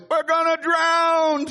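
high, slow, hammed-up terror; mock panic. Disciples in the storm crying out, high mock panic. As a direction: wail it like a doomed sailor. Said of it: "The storm hits".

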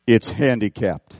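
put-on disgusted old man answering the pastor; gruff old man.

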